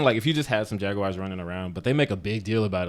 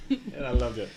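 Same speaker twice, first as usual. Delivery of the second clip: normal talking voice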